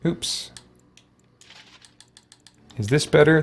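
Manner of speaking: usual speaking voice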